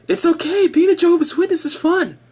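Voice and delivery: high, bright mock-believer voice; mock cheerful witness. Mocking a Witness's forced cheer, high sing-song. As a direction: parody a smiling Witness, pitched well up. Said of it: "supposed to tell themselves".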